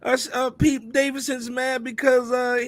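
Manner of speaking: high, mocking put-on voice of the press; sneering imitation of the news spin; mock media voice. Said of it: "trying to change the narrative, like".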